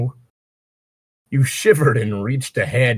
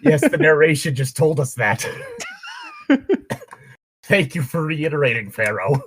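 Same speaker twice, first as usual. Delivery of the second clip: exasperated; 6 semitones higher than usual. Real, not acted. Exasperated outburst.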